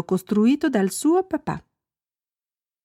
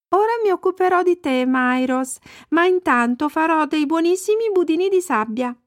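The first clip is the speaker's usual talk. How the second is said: put-on high little girl voice; Annika; 8 semitones higher than usual. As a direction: chatter to your cow as a child, pitched up. Annika parla alla mucca. (voce acuta da bambina)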